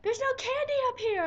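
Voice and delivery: high-pitched imitated friend's call. Friend's friend calling back from the porch, high holler.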